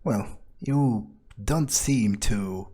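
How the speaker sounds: ordinary delivery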